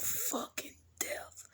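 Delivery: whispered confession. Real, not acted. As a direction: lean in and whisper it. Confides in a whisper.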